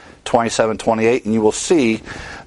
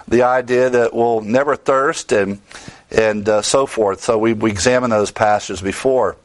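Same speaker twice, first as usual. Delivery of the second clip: ordinary talk, nothing marked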